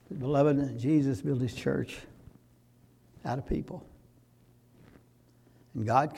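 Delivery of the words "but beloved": usual speaking voice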